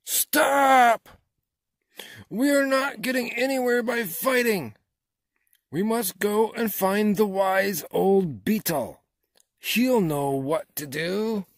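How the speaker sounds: high, very loud commanding shout; chief frog shouting. A narrator's reading. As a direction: shout over the squabbling to take charge. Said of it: "the chief frog shouted out".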